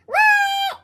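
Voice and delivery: high loud shout back; shouted answer. A narrator's reading. Froggy answering his teacher's call, loud shout. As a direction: holler it back from far off.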